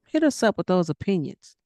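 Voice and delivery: normal talking voice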